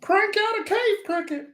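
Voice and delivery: high squeaky toilet paper puppet voice. Retold or imitated. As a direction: put on a high cartoon puppet voice. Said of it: "he kinda talks like this".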